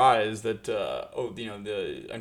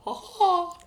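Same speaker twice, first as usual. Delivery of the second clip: teasing cackle; 4 dB louder than usual. Pretend. Voicing reality's teasing wink.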